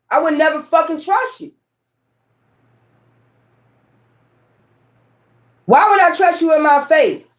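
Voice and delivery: heated rant. Real, not acted. Ranting to viewers about fake friends, heated and high. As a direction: let the outrage climb, pitch up high.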